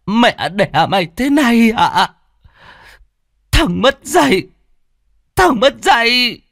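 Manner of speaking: put-on high, wailing elderly outrage; furious old mother. A narrator's reading. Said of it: "nghe con nói mà tức điên lên".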